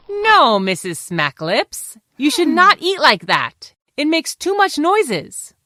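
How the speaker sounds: high, loud telling-off; animated scolding. Acted. Fox corrects Missus Smacklips for noisy eating, animated scolding. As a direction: correct them with emphatic disapproval.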